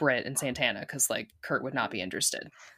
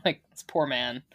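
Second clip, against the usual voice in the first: normal talking voice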